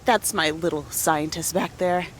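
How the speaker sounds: usual speaking voice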